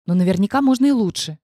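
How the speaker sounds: usual speaking voice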